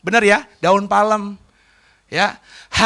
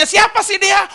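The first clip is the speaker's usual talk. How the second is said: curious townsperson; 10 semitones higher than usual. Retold or imitated. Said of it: "Orang mulai bertanya".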